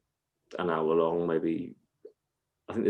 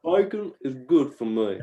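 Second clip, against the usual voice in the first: put-on higher comic child voice; little kid impression; 4 semitones higher than usual. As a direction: mimic a small boy's catchphrase. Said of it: "It's like this little kid that".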